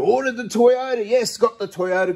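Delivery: high, loud eager buyer voice; mock eager buyer. Buyers rushing to order the Toyota, high mock eager voice. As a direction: sing out the order like an excited sheep following the crowd. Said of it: "what do people do".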